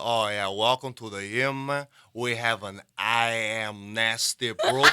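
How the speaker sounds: put-on loud, slow Cuban-accented English, syllable by syllable. Cuban coworker welcoming customers to the program, slow Cuban accent. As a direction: imitate a Cuban learner breaking AMNESTY into I AM NASTY. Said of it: "the way he pronounced it".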